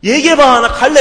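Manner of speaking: loud, high irritated demand; impatient shout at a late-night friend; impatient yell. Retold or imitated. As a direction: snap at a friend who won't talk. Acted impatient retort to friend.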